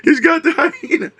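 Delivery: high, thrilled gasp of onlookers; excited tourists. Retold or imitated. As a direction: squeal it like a gawking safari crowd. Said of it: "They're, they're all looking".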